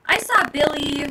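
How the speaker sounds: ordinary talk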